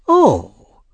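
mild, high exclamation; a touch of alarm from an old lady; old lady's cry. A narrator's reading. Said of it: "cried the old lady".

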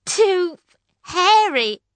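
bored princess. Acted. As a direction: drag it out as a spoilt princess. Prue rejecting princes.

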